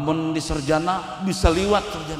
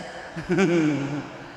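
chuckle; 2 semitones lower than usual. Real, not acted. Preacher laughing off his clumsy Malay, chuckle. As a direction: chuckle at your own slip.

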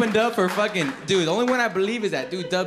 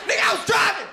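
rapper shouting; 7 semitones higher than usual. Retold or imitated. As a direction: yell it like the rapper on the track. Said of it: "He come out".

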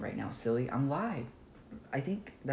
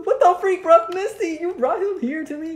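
high loud shocked shouting; outraged yelling; 14 dB louder than usual. Real, not acted. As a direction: yell in disbelief. Killer brought to him.

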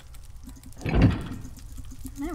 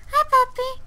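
very high, loud cutesy voice; 13 semitones higher, 7 dB louder than usual. Real, not acted. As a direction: coo at the puppy. Greeting a pet in game.

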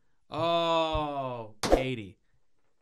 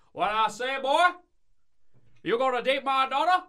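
mock Southern father sizing up the boy; cartoony Southern accent; 9 semitones higher, 7 dB louder than usual. Imitating Rachel McAdams' movie dad in a cartoony Southern accent. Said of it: "most cartoony Southern accent".

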